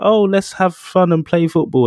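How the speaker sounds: high, bright, hammed-up chirp of a cheerful friend; mock cheery friend. Mocking a pushy friend, inviting the grouch to play football. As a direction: bounce in like a chummy pal proposing a game.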